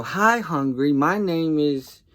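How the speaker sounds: high, loud joke greeting; mock introduction. Uncle answering hungry nephew with a dad joke, mock introduction. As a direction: pitch it up as a big hello to a stranger.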